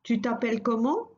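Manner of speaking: "Tu t'appelles comment?" spoken clearly, with a rising tune on "comment"; teacher's model, the question rising at the end; model phrase, rising question. Shown as a demonstration. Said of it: "Let's repeat it a few times".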